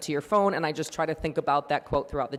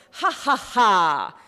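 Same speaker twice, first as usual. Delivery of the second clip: slow, loud, high staged ha ha ha; fake yoga laugh; 4 semitones higher, 7 dB louder, much slower than usual. Instructor calling the class to fake laugh. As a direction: belt out a forced laugh on cue. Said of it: "everybody's doing the noises".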